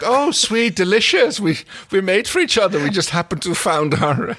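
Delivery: high, loud fake delight; squealing with exaggerated delight; mock gushing couple. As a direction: gush like smitten lovers, hammed up.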